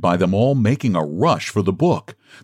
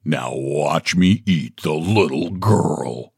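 lower, slow menacing bear; slow bear threat; 6 semitones lower, slower than usual. Acted. Bear advancing on the little girl, slow and menacing. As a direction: savour each word like a hungry bear.

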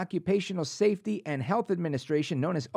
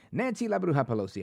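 normal talking voice

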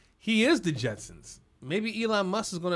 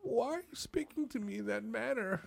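soft, formal protest; mock offended restraint; 3 semitones higher, 10 dB quieter than usual. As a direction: answer the boss with stiff, hushed politeness. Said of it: "I'd be like".

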